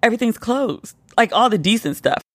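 ordinary delivery

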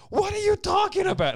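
put-on very high, loud little-kid Woody line; toddler copying Woody. Dad imitating his son's high, loud cartoon catchphrase. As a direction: squeak it like a two-year-old aping a cartoon. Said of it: "he looked up at me and said".

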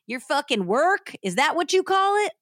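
loud, rising Southern incredulity; acted hillbilly fury, climbing in pitch; angry husband. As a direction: throw the question back in outrage. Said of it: "She heard Kenneth".

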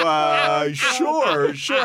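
high, timid sure sure; meek high reply. Retold or imitated. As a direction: squeak it out, crushed and polite. His meek reply, high pitched.

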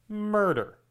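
ordinary talk, nothing marked

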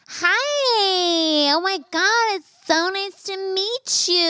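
very high, squealing feminine man's greeting; mock effeminate date, sky-high and drawn out. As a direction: gush hello in a high, sing-song feminine voice. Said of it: "like, more feminine than I did".

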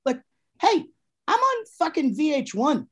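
high-pitched imitation of a new celebrity; acted-out self-importance; mock cocky bragging. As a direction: play someone puffed up by TV fame. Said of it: "just being like".

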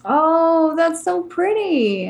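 high, drawn-out delight; admiring coo. Real, not acted. Co-host is shown an illustration, admiring coo.

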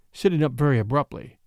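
plain reading voice

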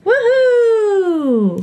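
high whooping cheer; excited cheer. Real, not acted. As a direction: whoop with delight. Cheering the dark case.

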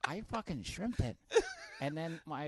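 ordinary delivery

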